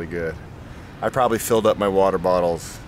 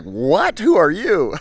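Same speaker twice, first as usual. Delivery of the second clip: mock surprise; 8 semitones higher, slower than usual. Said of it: "And I was like".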